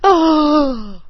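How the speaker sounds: startled oh. Retold or imitated. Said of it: "And I'm like".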